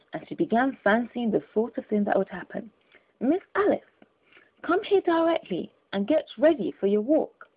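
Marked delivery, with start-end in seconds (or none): 3.2-7.5 nurse calling. Pretend. Alice imagines the nurse calling.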